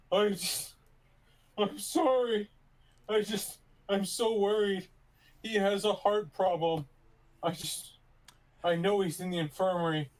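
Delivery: high, hammed-up worried pleading; mock tearful pleading. Character fake crying to the guard about grandpa. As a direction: keep up the fake tears.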